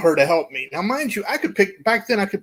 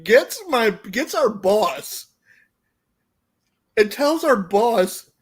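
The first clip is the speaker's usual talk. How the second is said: usual speaking voice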